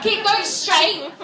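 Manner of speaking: imitated chav English; British chav. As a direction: give directions like a British chav. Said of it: "a British chav would sound politer".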